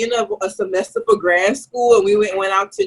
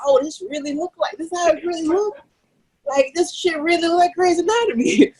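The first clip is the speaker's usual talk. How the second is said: amazed disbelief; 8 semitones higher than usual. Retold or imitated. Wheeled into OR, scared.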